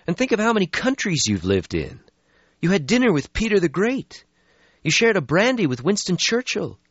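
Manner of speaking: cheering up a friend. Acted. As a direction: talk him out of the gloom. Lucky voiced in story.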